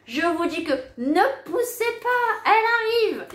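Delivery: high, loud, alarmed order; suddenly alarmed midwife at the door; urgent midwife. Retold or imitated. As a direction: mimic the panicked midwife bursting in. Midwife suddenly sees the head.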